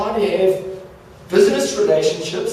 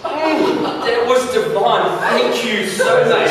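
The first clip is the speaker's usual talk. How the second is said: mock polite praise; 5 semitones higher, 4 dB louder, slower than usual. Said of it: "And you go".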